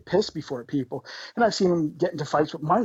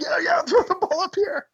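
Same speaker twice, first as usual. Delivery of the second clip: much higher, calling down from the balcony. Retold or imitated. Chris calling down to kids from the balcony, excited. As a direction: hype the kids below to throw the ball up. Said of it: "he's like".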